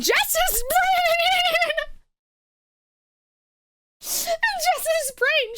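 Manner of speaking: very high, drawn-out words squeezed through laughter; slow squeal as real laughter takes over; cracking up. Real, not acted. Teller cracking up mid-sentence.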